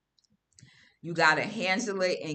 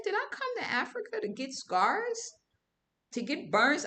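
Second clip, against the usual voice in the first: much higher, exaggerated incredulous wail; mock dismay; 8 semitones higher than usual. Seeing a burn scar in the mirror, higher mock dismay. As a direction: whine in theatrical disbelief.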